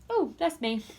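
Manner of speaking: plain reading voice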